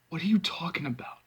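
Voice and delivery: soft, choked-out question; the air knocked out of him; stunned by the news, choking it out quietly. Acted. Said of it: "I choke".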